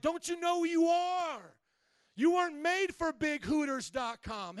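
loud, high, slow shout of Jesus; Jesus rebuking. Retold or imitated. Jesus calling to a tempted believer, loud slow rebuking proclamation. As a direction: proclaim it to wake them up. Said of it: "Jesus is like".

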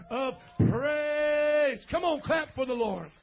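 loud, high, drawn-out cry of praise; rally the room with a long shout; rousing praise shout. Real, not acted. Pastor calling youth to clap for Jesus, rousing shout.

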